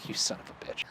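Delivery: breathy mock insult under breath; whispered. Real, not acted.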